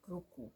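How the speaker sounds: Ruku said softly, as the answer for the class to copy; soft, falling Ruku as a model word; Cañari word ruku. Shown as a demonstration. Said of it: "Cómo se dice a un anciano".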